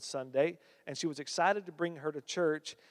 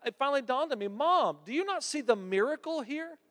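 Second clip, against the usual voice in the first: higher, urging her mother to see it; earnest daughter; 10 semitones higher, 3 dB louder than usual. Retold or imitated. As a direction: press her mom gently but urgently. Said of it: "She said".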